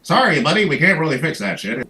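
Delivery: a hint of an uncaring insurer; mock cold company rep. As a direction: dismiss the sick kid breezily. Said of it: "and say".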